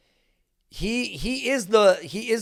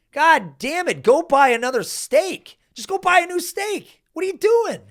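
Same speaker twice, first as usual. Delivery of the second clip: higher and louder, building; exasperated rant; 9 semitones higher, 4 dB louder than usual. Real, not acted. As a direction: yell like you're scolding someone at the trash can. Mock rant at a steak eater.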